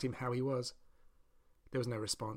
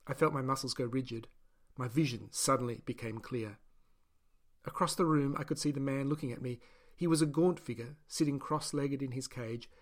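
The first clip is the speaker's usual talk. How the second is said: ordinary delivery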